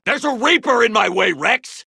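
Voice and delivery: urgent combat call; battle shout. Acted. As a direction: yell across the field. Shouting to ally over battle.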